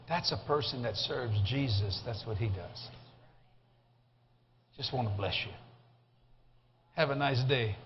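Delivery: low, soft, measured kindness; gentle blessing. Retold or imitated. Passenger blessing the driver who serves him, gentle. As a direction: bless him quietly and calmly.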